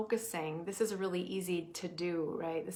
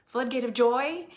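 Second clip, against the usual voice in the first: louder and higher, lifted with joy; joyful; 5 semitones higher, 9 dB louder than usual. Shown as a demonstration. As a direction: let the joy ring out on it. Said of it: "When I say the word".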